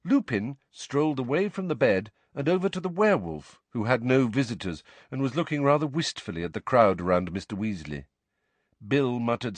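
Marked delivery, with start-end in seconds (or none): none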